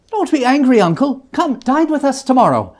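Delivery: put-on high, coaxing young voice; cheerful nephew. Nephew coaxing his cross uncle, high and light. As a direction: lift the voice and coax him.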